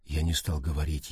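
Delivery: plain reading voice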